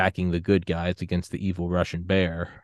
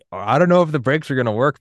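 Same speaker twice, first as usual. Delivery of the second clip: higher, louder mock worry; mock worried doubt; 7 semitones higher, 6 dB louder than usual. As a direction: voice the panicked doubter lightly. Imagined doubter on the icy hill.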